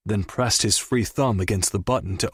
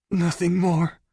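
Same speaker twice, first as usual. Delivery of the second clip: frightened denial; 8 semitones higher than usual. Acted. Captive denies, terrified.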